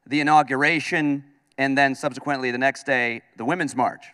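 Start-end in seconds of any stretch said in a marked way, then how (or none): none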